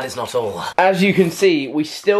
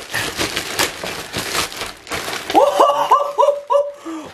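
breathless high-pitched giggle; high giddy laugh. Real, not acted. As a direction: burst into a squealy laugh. Giddy over the goodies.

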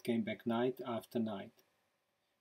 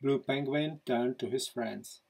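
plain reading voice

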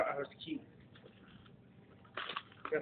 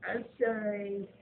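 mocking fake apology; 2 semitones higher, 4 dB quieter, slower than usual. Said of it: "No, you're not sorry".

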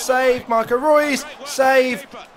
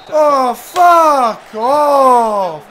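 drawn-out furious yell; 2 semitones higher, 6 dB louder, much slower than usual. Real, not acted. Gamer cursing the match on screen, drawn-out loud yell. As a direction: stretch every word out, loud and disgusted.

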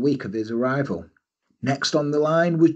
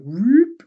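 whoop sound effect; 8 semitones higher, 7 dB louder than usual. Pretend. Running backwards.

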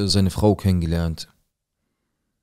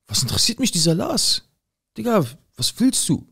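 much higher, put-on mocking voice; mocking customer voice; 14 semitones higher than usual. Mimicking the angry customer confronting him: high mock voice. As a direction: parody the rude customer, high-pitched.

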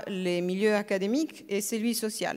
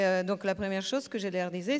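usual speaking voice

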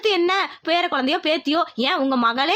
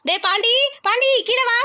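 calling out; 6 semitones higher than usual. Acted. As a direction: call him down from the next floor. Mother calls son from afar.

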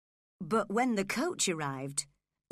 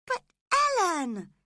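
high, loud, shocked call; horrified cry; 10 semitones higher, 7 dB louder than usual. Acted. Girl appealing to her nurse, horrified and loud. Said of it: "she cried, staring horrified".

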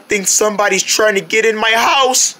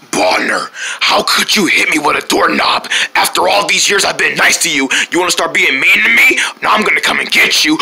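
low menacing voice; wounded friend turning sinister; scary Tun; 5 semitones lower than usual. Acted. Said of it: "not the scary Tun".

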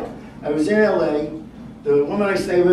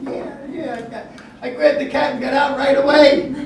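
higher, easy and offhand with a laugh; casual old man; 10 semitones higher, 4 dB louder than usual. Retold or imitated. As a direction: toss it off like it was nothing, pitched up. Sooty old man explains casually.

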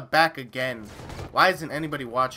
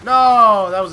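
a much higher, much louder "No"; loud protest; 7 semitones higher, 14 dB louder than usual. Real, not acted. Gamer cries out at the wrong target. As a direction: bark out a sharp no. Sudden miss.

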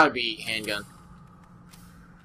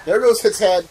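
higher, louder echo of the line; excited echo; 7 semitones higher, 6 dB louder than usual. Acted. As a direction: call it out high and loud. Echoes partner's line, excited.

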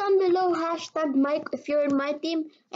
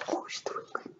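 whispering; 12 dB quieter than usual. Real, not acted. Secret remark while she draws.

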